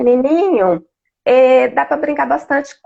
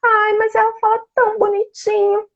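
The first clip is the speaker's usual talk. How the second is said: high, doting mom voice; doting parent; 10 semitones higher than usual. Retold or imitated. Speaker imitates a doting mother excusing baby talk, high voice. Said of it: "Então, assim".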